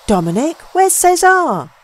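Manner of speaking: sudden high, loud cry of alarm; frightened cry. A narrator's reading. Young man cries out to Dominic in alarm, high and loud. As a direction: cry out in fear for the missing man. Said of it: "I cried".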